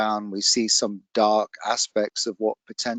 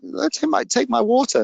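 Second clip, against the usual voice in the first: much higher, stumbling insistent offer; retold offer pitched up high, pressing it on the listener; high-pitched imitation; 13 semitones higher than usual. Trekker offers her water to a thirsty walker, high-pitched imitation.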